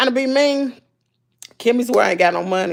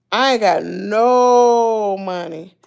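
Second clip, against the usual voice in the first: drawn out; 4 dB louder, much slower than usual. Real, not acted. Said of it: "drawn out".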